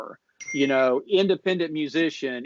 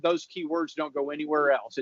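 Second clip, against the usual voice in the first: ordinary delivery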